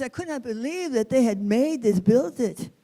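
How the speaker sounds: ordinary delivery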